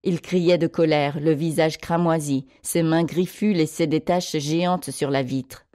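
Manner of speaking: ordinary delivery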